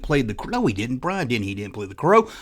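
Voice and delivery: ordinary delivery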